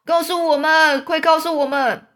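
loud, high demanding cry; shouting. A narrator's reading. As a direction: shout it out insistently. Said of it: "蚯蚓就大喊说".

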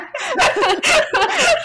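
long rising high-pitched giggle; can't stop giggling. Real, not acted. Laughing about the coworker who ordered a Coca, high giggle.

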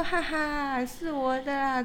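lightly mimicked smug "haha"; mock gloating laugh. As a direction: imitate someone laughing and showing off. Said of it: "还在那边笑说".

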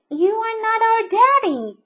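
put-on very high, loud child voice; bunny children. A narrator's reading. Bunny children to the intruding mole, high protest. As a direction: pipe up high as little bunnies.

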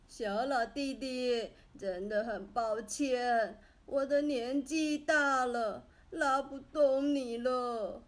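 put-on an old man sheepishly admitting he can't pull; apologetic old man. A narrator's reading.

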